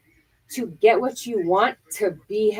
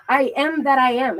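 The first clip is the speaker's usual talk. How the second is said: ordinary talk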